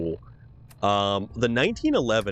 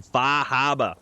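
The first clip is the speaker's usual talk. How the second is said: R-less Massachusetts "Fah Habah"; Massachusetts accent; 2 semitones higher, slower than usual. Acted. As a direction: drop the R's, Massachusetts style.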